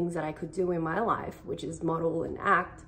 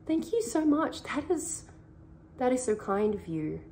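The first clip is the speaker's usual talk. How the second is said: sweetly accepting, higher in pitch; gracious thanks; 6 semitones higher than usual. Retold or imitated. Showing how to accept a compliment, bright and higher. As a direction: receive the compliment gladly and sincerely. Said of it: "just being like".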